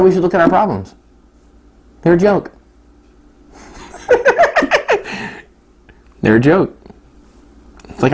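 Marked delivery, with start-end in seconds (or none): 4.1-5.3 sharp high falsetto laugh. Real, not acted. Preacher mocking problems as a joke, high laugh.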